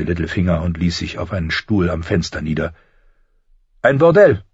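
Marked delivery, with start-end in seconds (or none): 3.8-4.4 high, loud, mocking schemer. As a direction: announce it gleefully in a high-pitched voice. Littlefinger's amused answer to Ned.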